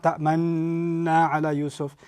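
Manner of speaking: "Ta'manna" with the noon drawn out long; "Ta'manna ala Yusuf" stretched and slow; wrong extended noon. Shown as a demonstration. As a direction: hold the nn in "Ta'manna" too long. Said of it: "It's not".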